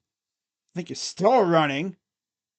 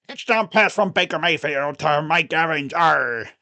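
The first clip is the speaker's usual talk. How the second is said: pirate stadium announcer; 4 dB louder than usual. Pretend. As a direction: call it out as a cheesy pirate announcer. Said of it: "P A announcer for the Buccaneers just talks in pirate voice".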